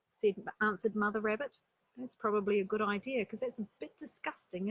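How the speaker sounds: ordinary delivery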